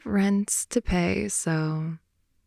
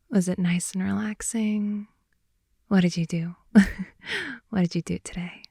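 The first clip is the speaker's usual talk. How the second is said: ASMR whisper. Acted. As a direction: whisper it close to the ear, winding down.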